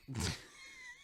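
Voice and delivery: a sudden squeal of a laugh, very high; squeaky high laugh. Real, not acted. After reading a flirty message aloud, squeaky laugh.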